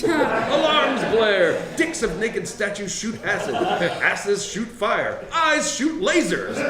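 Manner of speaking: high, slow, drawn-out exclamations; hyped-up action narration. A narrator's reading. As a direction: build the chaos up to a high shout. Narrator hypes the trap chaos.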